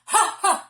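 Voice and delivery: short punchy loud "ha" sounds; loud "ha" vocal exercise. Shown as a demonstration. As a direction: belt out staccato "ha"s. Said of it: "So I'm going to do ha".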